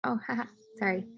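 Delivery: embarrassed little laugh while apologizing; nervous laugh. Real, not acted. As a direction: laugh it off sheepishly. Embarrassed at screen mix-up.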